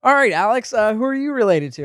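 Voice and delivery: imitating a loud, high radio host; big on-air host voice; radio host greeting. Retold radio hosts greeting a caller.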